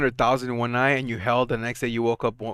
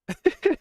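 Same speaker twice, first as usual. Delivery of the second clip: short high laugh. Real, not acted. Laughs at Orange County.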